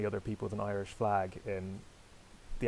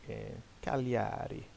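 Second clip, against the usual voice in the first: put-on Cagliari in a light Italian accent; slower than usual. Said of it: "because I like saying things in an Italian accent".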